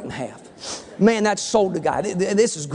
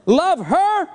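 incredulous; 9 semitones higher than usual. Acted. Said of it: "You ever talk like that?".

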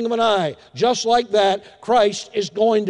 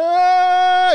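loud, high, sustained mock wail; piercing imitation of an infant's cry; imitated baby crying; 10 semitones higher, 6 dB louder than usual. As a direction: bawl like a baby in the night. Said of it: "they are just crying".

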